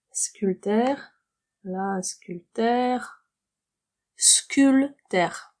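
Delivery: «sculpteur» said slowly, syllable by syllable, p silent; slow pronunciation drill. Shown as a demonstration. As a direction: stretch out «sculpteur» for learners.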